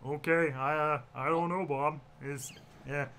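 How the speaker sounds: put-on cartoon character voice; halting attempt at a cartoon character; Teddy. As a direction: do the hesitant sitcom regular. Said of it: "you mean the Teddy voice".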